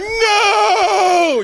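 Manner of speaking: very high, loud, crying shout; anguished no. Retold or imitated. Comic begging girlfriend for forgiveness, anguished crying shout. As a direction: wail it in despair. Desperate cry.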